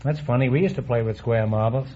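ordinary talk, nothing marked

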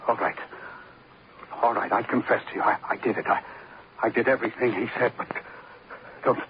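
halting, breathless surrender under threat; frightened confession. Acted. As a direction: stammer it out, begging for your life. Terrified confession at gunpoint.

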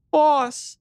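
high, loud cry of the little sister; voice-breaking cry. A narrator's reading. Frightened sister calling out to her rescuer, voice breaking. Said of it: "her voice breaking, she cried out".

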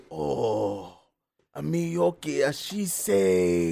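slow, halting fake Japanese accent; mock Japanese accent. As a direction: drag the words out in a hammed-up Japanese accent. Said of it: "squinches his face at me. He goes".

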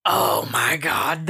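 low, drawn-out awe; slow, emphatic amazement; awed gushing. Real, not acted. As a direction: stretch it out slowly, really impressed. Gushing over how cool they look.

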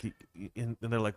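ordinary talk, nothing marked

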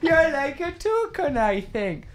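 hammed-up mock repeat to a friend; mocking parrot. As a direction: mimic her verdict in a silly voice. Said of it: "Why? I'm not the toucan".

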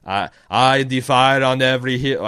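put-on loud, declaimed Austrian-accented boast; Arnold Schwarzenegger. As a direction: proclaim it like an action-hero incantation. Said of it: "sounds like I'm doing an Arnold Schwarzenegger".

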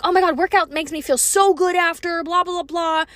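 high-pitched imitation of gym enthusiasts; mock gushing. Podcaster mimicking everyone who loves post-workout feelings, mock gushing. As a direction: gush mockingly, pitched well up, ending in dismissive blahs. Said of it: "Everyone's like".

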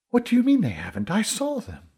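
high, fast, incredulous challenge; indignant disbelief. Acted. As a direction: fire back in disbelief, pitched well up. Essa challenges the narrator's denial.

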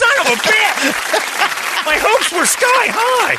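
very high, loud drawn-out yell; anguished outburst. Retold or imitated. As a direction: wail it slowly in comic outrage. Acts out his crushed hopes.

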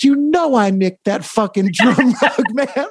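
high-pitched quote breaking into laughter; laughing punchline. Retold or imitated. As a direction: deliver the retold confession, cracking up as you go. Punchline of retold story, laughter heard.

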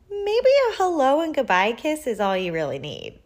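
reasoning mama. A narrator's reading. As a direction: gently suggest a compromise to a child. Mama reasoning with her son.

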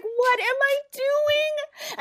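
high, loud, drawn-out self-reproach; mock panic. Woman appalled at her own rambling, panicked.

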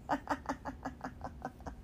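soft airy laugh after a joke; a quiet real chuckle; breathy laugh. Real, not acted. Laughs after joke about husband.

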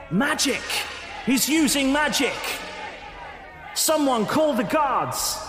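high, urgent crowd cries of alarm; crowd shouting. Acted. DM voicing townsfolk crying out about magic. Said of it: "You hear them shouting".

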